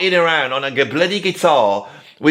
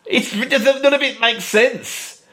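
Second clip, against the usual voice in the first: high, slow, half-laughing exasperation; exasperated; 6 semitones higher, slower than usual. Real, not acted. Reviewer despairing at the nonsensical lyric, exasperated laugh. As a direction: give up on it in laughing disbelief.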